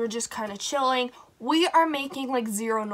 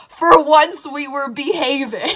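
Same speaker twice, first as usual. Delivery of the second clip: louder, slow, drawn-out protest of innocence; mock innocent plea; 4 semitones higher, 10 dB louder than usual. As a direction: overplay the innocent kid. Kids protesting innocence to moms.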